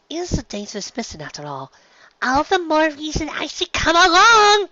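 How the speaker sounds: excited filly. Acted. Little sister exclaiming she should come along. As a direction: insist with childish eagerness. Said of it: "Sleebell exclaimed".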